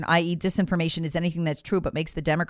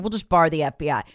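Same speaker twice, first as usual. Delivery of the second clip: ordinary talk, nothing marked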